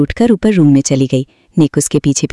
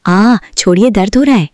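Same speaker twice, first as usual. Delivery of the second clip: mock pain; 6 semitones higher than usual. A narrator's reading. Ear pulled by mother-in-law.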